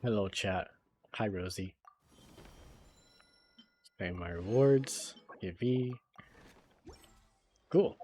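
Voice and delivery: usual speaking voice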